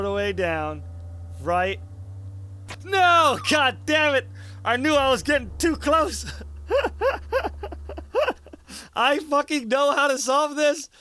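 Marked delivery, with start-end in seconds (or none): 2.8-6.3 annoyed gamer outburst; frustrated yell. Real, not acted. 6.3-8.9 high, breathy frustrated laugh; pained giggle. Real, not acted. Laughs after failing.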